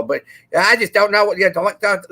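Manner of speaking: imitating Ward Burton. Retelling a NASCAR brother climbing from the car, mock thick Southern accent. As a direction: toss off a hard-to-follow Southern racer's line. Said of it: "you had no clue what the heck he was saying".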